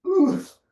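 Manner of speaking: sharp high yelp of effort; pained cry of exertion; strained oof. Real, not acted. Straining in a deep pose.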